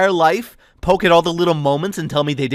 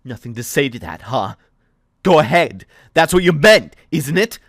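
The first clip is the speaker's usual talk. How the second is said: cold challenge; 9 semitones higher, 19 dB louder than usual. Acted. Thea daring Aiden to answer, bitter.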